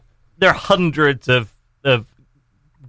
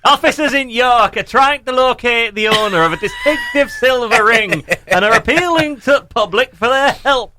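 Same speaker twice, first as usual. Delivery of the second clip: slow, higher rustic Hobbit English read; Hobbit accent; 6 semitones higher, slower than usual. Acted. Co-host reading the police appeal in a Hobbit accent, laughing. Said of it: "I could do a really good North Yorkshire Police".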